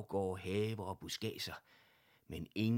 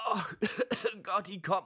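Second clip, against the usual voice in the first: high coughing voice, louder; 12 semitones higher, 8 dB louder than usual. A narrator's reading. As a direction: cough out relief from the box. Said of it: "hostede Monsieur Paul".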